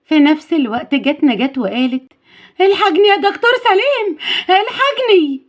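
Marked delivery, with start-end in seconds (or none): 2.6-5.4 high-pitched cry for help; panicked maid, acted in a story; urgent plea. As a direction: call out in alarm to the doctor. Maid runs calling for help.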